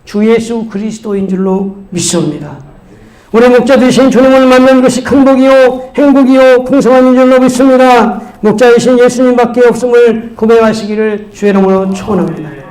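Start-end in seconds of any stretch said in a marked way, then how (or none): 3.3-12.6 high, projected preaching; forceful pulpit proclamation. Real, not acted. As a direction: exhort the congregation with rising conviction.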